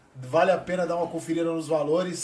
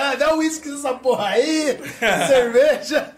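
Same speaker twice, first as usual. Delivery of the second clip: mock shout; 7 semitones higher, 5 dB louder, slower than usual. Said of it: "ele grita".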